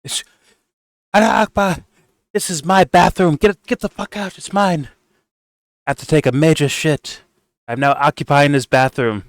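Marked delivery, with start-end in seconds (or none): 1.1-9.2 mock militant voice. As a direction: ham up a soldier claiming the bathroom. Parody of a Hamas soldier.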